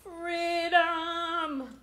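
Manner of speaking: high, drawn-out mock battle cry; half-sung imitation of a defiant scream; imitated movie scream. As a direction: cry it out long and high like a dying film hero. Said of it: "he screams out".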